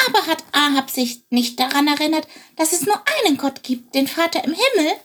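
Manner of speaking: high, puzzled little bird; wondering sparrow. Acted. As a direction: ask in wonder, like a small sparrow. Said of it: "wunderte sich Chilp".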